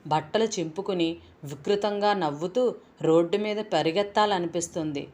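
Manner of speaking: ordinary delivery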